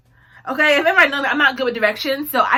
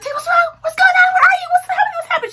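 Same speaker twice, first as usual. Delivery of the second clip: imitated panicked mom, very high and loud; high-pitched mock alarm; frantic, upset mother; 8 dB louder, faster than usual. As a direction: fire off frantic questions in a shrieking mother voice. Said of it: "She's very frustrated".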